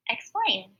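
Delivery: Explain, both syllables joined smoothly; blended word said clearly. Shown as a demonstration. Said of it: "I get the word explain".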